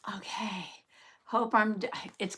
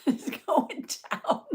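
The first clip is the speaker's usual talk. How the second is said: laughing; 11 semitones higher, slower than usual. Acted. Repeating blood count dropping.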